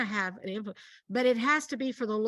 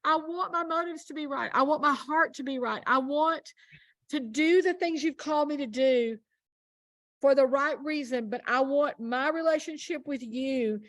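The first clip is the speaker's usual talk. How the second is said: prayer; 3 semitones higher than usual. Retold or imitated. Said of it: "And I'm like".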